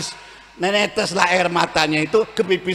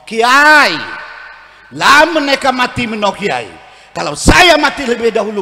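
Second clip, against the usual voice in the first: wife's plea; 5 semitones higher, 10 dB louder than usual. Retold or imitated. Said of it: "dia ngomong".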